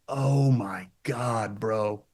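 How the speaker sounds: slow, soft, drawn-out awe; retold awestruck realization. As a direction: let it out slowly and quietly, mimicking a mind blown. Retold awe on getting the joke.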